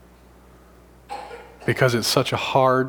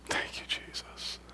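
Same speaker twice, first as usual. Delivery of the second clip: softer, breathy thanks; a hushed, reverent thank you; whispered praise; 14 dB quieter than usual. Real, not acted. Preacher overcome by the story, whispering thanks to Jesus.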